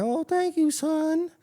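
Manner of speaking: put-on high, sweet old-lady voice. Ruby thanking the boy who pulled her weeds, in a high sweet old-lady voice. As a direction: thank the boy like a kindly grandma. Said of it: "She's like".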